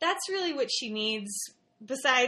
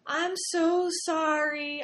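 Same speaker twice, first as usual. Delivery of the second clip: put-on slow, higher, tearful little-girl apology; sobbing apology; 6 semitones higher, slower than usual. Daughter apologizing to mom after throwing a rattle, tearful. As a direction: mimic a sobbing child saying sorry. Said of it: "She was like".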